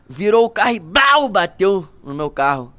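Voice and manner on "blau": sudden punchy impact sound; imitated crash noise. Storyteller re-enacting bus hitting his car, imitated bang. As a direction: hit it like a car smashing.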